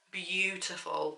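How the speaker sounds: ordinary talk, nothing marked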